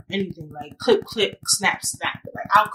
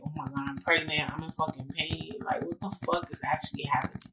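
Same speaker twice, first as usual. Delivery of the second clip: hushed despair; 5 semitones lower, 11 dB quieter than usual. Retold or imitated. Alone in car, in shock.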